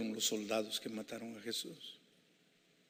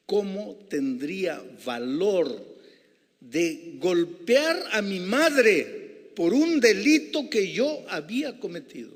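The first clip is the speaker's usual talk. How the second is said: anguished question; 7 semitones higher, 13 dB louder, slower than usual. Acted. Preacher's anguished rhetorical question.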